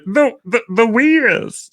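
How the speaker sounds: high, slow stuttering cartoon voice; the Wiz. Shown as a demonstration. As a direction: drag out the Wiz like a stammering Martian. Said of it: "if you take Marvin the Martin".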